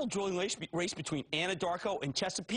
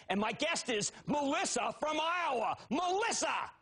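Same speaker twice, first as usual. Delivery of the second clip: big welcome; 9 semitones higher than usual. Real, not acted. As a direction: hype up the caller's entrance. Host introducing caller.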